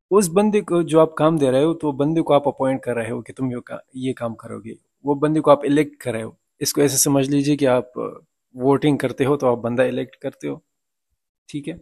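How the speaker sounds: usual speaking voice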